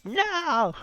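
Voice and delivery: high, anguished cry of dismay. Retold or imitated. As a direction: wail it as the plan falls apart. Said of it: "I was like".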